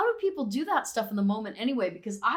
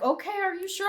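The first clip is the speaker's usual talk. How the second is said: unsure clerk; 10 semitones higher, faster than usual. Retold or imitated. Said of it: "And he's like".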